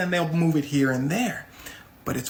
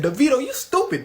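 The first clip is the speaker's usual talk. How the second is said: loud, higher-pitched taunt; mock insult; 7 semitones higher, 5 dB louder than usual. [name] argues online with a famous singer, taunting. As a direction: throw the insult out high and loud.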